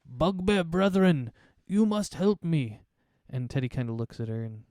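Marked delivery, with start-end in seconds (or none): 0.0-2.8 slow, higher pleading bugbear woman; bugbear wizard. Acted. Female bugbear wizard begging Teddy for help, slow and higher. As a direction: beg the bugbear for help, slowly. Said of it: "and she says".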